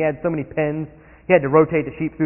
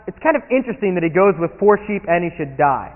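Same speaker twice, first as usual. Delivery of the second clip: normal talking voice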